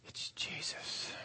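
breathless slow whisper; awed whisper. Acted. Crowd member realises it's Jesus, whispered slowly. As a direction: hush it in awe.

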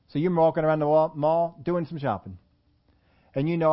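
ordinary talk, nothing marked

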